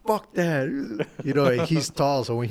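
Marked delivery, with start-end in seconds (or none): 0.1-0.7 angry. Retold or imitated. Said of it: "he was angry".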